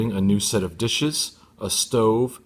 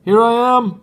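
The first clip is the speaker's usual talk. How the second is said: high, loud yell from out of sight; 14 semitones higher, 9 dB louder than usual. Acted. Tony yells to Ron from under the porch, high and loud. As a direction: call out, hidden somewhere. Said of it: "I heard a voice yell".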